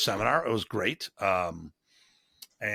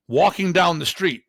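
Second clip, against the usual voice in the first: usual speaking voice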